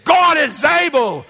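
high, slow, ringing declaration; emphatic proclamation. Acted. Early church declaring shared faith, bold proclamation. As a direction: proclaim it boldly, drawing it out.

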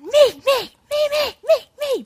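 put-on very high squealing child; kids squabbling. Two kids fighting over toys, very high me squeals.

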